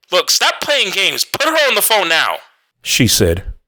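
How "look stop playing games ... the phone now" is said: put-on a mother demanding her child back, panicking; panicked mother. A narrator's reading. The mother demands the babysitter put her daughter on, high and panicked. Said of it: "Her voice jumped, loud and panicked".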